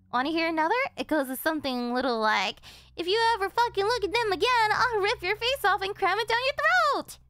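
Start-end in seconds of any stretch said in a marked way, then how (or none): 3.0-7.2 loud, fast, higher threat disguised as a joke; high, loud threat delivered as a "joke"; menacing mock joke. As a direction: spit the threat at the woman you're choking. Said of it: "Little joke".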